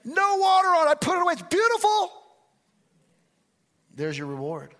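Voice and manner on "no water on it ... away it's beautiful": high, loud bragging voice; mock boastful volunteer. Mocking a volunteer who brags about the hose, high bragging. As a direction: brag like a proud show-off. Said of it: "They say".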